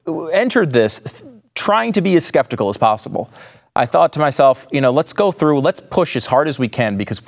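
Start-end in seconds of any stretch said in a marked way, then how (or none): none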